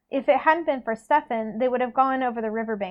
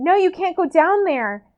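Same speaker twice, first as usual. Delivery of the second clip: higher, louder cry of protest; restrained scream; 7 semitones higher, 5 dB louder than usual. A narrator's reading. Meredith trying to stop Stefan going down, a half-scream. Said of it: "Meredith was screaming too".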